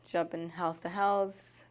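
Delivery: ordinary delivery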